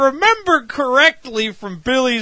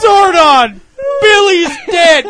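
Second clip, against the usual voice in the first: raised, hammed-up wail of bad news; mock distraught cry; 7 semitones higher, 3 dB louder than usual. Rangers report Billy's death to Zordon, distraught cry. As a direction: call out in mock grief.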